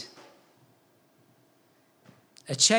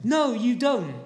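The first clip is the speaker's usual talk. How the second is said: ordinary talk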